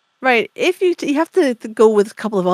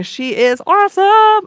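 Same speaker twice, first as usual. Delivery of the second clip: excited; 10 semitones higher, 6 dB louder, slower than usual. Real, not acted. Gushing about the band's first singer.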